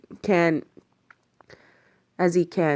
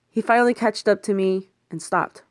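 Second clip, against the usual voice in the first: ordinary talk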